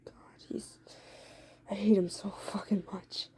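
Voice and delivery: whispered hatred under the breath; angry whisper. Acted. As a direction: hiss it in a whisper, full of spite. Eddie muttering hatred of the boyfriend.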